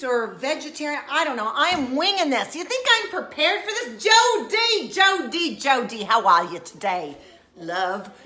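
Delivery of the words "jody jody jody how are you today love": put-on British accent. Host greeting viewer Jody, mock British accent. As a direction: call the name in mock British, then settle down. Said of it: "that's my British slash Nigel".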